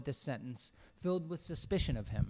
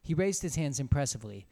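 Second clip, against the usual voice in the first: usual speaking voice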